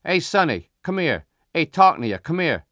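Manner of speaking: Italian shopkeeper calling out loudly. Acted. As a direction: summon a kid from the gate. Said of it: "He's calling me".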